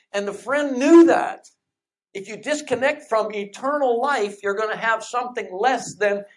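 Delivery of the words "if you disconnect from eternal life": usual speaking voice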